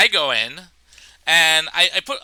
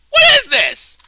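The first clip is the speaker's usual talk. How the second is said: high, loud comic bewilderment; hammed-up baffled yell; mock baffled shout; 7 dB louder than usual. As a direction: demand it loudly, high in the voice. Acted baffled TSA agent.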